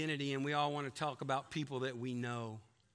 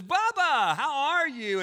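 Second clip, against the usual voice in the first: much higher and louder, delighted to see him; excited greeting; 11 semitones higher, 11 dB louder, slower than usual. Acted. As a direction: shout his name like an old friend. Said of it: "Tom Cruise opens the door and says".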